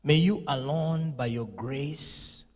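ordinary talk, nothing marked

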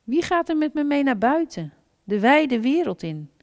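put-on high, childlike little hedgehog voice; high little hedgehog call. A narrator's reading. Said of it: "kleine egel ... zei".

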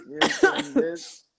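slow, drawn out, high and loud; dramatic build-up. Shown as a demonstration. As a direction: stretch it out for suspense. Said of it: "drawn out, high and loud".

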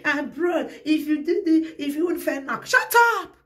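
mock shout. Quoting them telling her off.

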